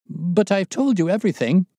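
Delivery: high, loud, desperate protest; jangled nerves. A narrator's reading. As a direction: protest anxiously, voice shooting up. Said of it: "as well as his voice testified to his jangled nerves".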